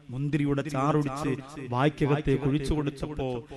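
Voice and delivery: low, soft and quick, drawing the crowd in; hushed storytelling. Acted. Preacher hushes the crowd to tell the tragic story. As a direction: drop to a hushed, hurried retelling.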